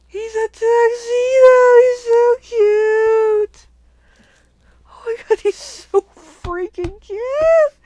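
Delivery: high, loud, slow gushing squeal; excited squeal. Real, not acted. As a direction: squeal like you've found the cutest thing ever. Squealing over the tuxedo die.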